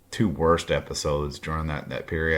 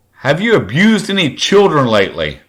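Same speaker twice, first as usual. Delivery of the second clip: high, loud retold confrontation; loud pointed question; 9 semitones higher, 11 dB louder than usual. Stepson confronting stepmother in a crowded pharmacy, loud and pointed. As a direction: call it out so the whole crowd hears.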